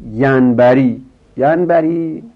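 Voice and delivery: یرتجی، یرتجیان، یرتجونه called out high and loud; reciting forms. Shown as a demonstration. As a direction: announce the forms to the class. Teacher announcing next verb.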